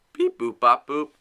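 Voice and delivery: loud beep boop sounds; dial tone beeps. Pretend. Mimicking phone dial tones.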